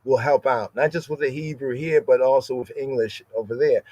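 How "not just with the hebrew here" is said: ordinary talk, nothing marked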